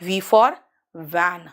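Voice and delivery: teacher showing the V sound; bitten v demo. Shown as a demonstration. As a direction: bite the lip on van. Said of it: "देखा".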